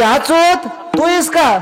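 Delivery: high-pitched neighbour's call; imitating old village woman. Old neighbour woman calls to the speaker, imitated high call. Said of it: "ती म्हातारी".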